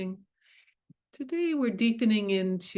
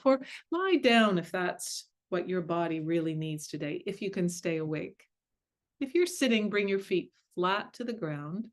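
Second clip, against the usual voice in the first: guided meditation. Acted. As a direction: ease them gently into stillness.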